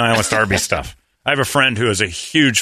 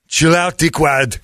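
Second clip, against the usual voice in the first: put-on higher, louder Arnold accent; 7 semitones higher, 3 dB louder, slower than usual. Customer answering the mask clerk in an Arnold accent. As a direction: brush him off like Arnold. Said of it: "in that accent".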